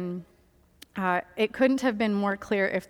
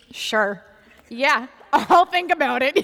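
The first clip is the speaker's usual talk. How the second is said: sarcastic agreement; 3 semitones higher, 7 dB louder than usual. Retold or imitated. Recalled skeptical reply to advice.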